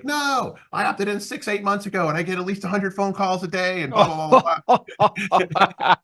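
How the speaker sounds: hammed-up fed-up customer venting; imitating irate caller. Retelling the lead who was sick of calls, mock exasperated rant. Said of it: "And he was still yelling at me".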